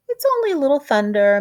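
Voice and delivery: breezy cheerful reassurance; a fast chirpy it's nothing; quick bright reassurance. Acted. Reassuring wife quote.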